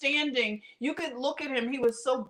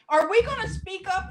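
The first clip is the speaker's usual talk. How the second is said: rallying call; 8 semitones higher, 4 dB louder than usual. Retold or imitated. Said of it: "I said".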